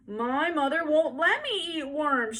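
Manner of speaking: put-on high, loud, slow little boy's shout; loud child's protest. A narrator's reading. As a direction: shout the rule back at her. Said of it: "shouted a little boy".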